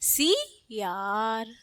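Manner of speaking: सियार as short "si", pause, long "yaar"; slow, split "si... yaar" for सियार. Shown as a demonstration. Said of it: "इसमें भी छोटी लगी है".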